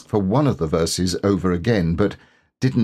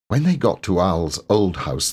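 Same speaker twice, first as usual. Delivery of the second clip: ordinary delivery, nothing marked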